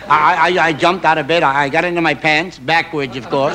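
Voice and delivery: ordinary delivery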